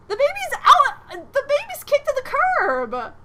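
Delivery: very high, loud outcry; shocked exclamation. Real, not acted. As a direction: yelp in disbelief. Shocked discovery in game.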